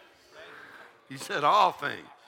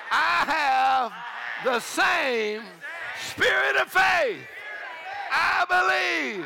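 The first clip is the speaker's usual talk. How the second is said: high, drawn-out shouted declaration; fervent faith chant in short bursts; full-force preaching; 11 semitones higher, 5 dB louder, slower than usual. Real, not acted. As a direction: proclaim each phrase slowly to rouse a crowd.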